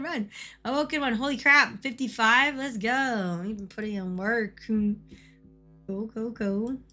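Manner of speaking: usual speaking voice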